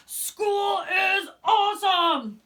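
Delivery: high, loud, slow shout; excited shark shout. A narrator's reading. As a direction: shout it with pure joy. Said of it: "shouted Clark the Shark".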